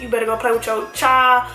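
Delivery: normal talking voice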